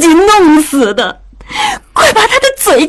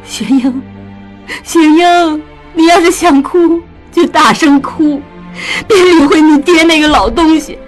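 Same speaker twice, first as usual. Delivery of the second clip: tearful. A narrator's reading. Weeping mother comforts her heartbroken daughter. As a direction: coax her to let it all out. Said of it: "江母落泪道".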